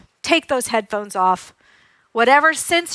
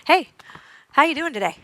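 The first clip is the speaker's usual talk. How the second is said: matching energy; 3 semitones higher than usual. Retold or imitated. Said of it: "I just matched his rhythm".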